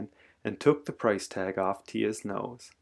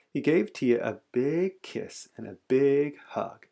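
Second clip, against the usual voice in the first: usual speaking voice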